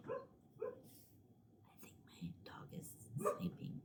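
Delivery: hushed whisper so the dog sleeps on. Real, not acted. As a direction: whisper so you don't wake the dog. Dog asleep nearby.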